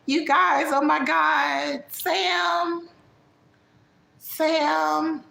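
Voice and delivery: high, drawn-out dramatic caricature; high, slow mock-dramatic impression; Vegas. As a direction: call to friends in a high put-on voice. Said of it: "imitate Vegas".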